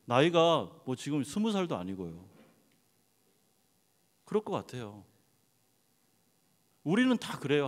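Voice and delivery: ordinary talk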